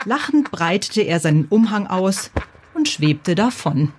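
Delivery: usual speaking voice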